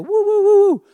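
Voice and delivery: high loud whooping cheer; mock victory whoops. Fireman celebrates making it out, high loud whoops. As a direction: whoop it out like a triumphant paddler.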